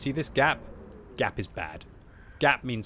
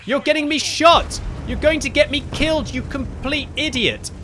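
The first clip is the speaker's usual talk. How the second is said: screaming high and very loud in real rage; furious shout; 10 dB louder than usual. Real, not acted. Raging at the teammate who will get him killed, very loud shout. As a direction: explode at him, full voice. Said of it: "sorry for getting frustrated".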